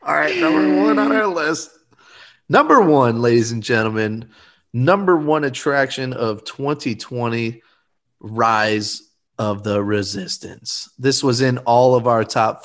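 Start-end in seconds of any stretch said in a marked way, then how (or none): 0.0-10.9 big countdown reveal; top ten countdown. Acted. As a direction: announce the winner with fanfare. Announcing number one ride.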